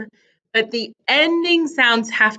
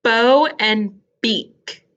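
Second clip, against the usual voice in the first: ordinary delivery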